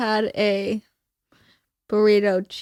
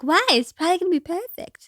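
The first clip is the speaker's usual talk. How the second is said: higher, quicker quoted voice; imitated friend; 7 semitones higher, faster than usual. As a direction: lightly imitate the friend, pitched up. Quoting a friend's reassuring reply.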